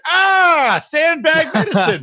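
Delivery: high, drawn-out "aah" of sudden enlightenment; mock revelation. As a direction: exclaim it like a miracle cure, hammed up. Mock awe at sandbag remedy.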